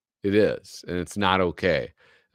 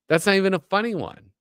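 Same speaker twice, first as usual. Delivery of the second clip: incredulous high; 11 semitones higher, 3 dB louder, faster than usual. Real, not acted. Incredulous at comic.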